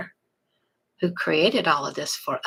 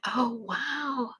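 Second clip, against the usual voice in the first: surprised realization; 5 semitones higher, slower than usual. Pretend. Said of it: "It's kinda like".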